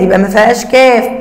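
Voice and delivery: normal talking voice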